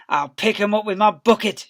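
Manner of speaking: loud, gleeful threat; shouting. Acted. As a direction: yell it with nasty glee. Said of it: "shouted Bunce".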